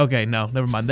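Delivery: normal talking voice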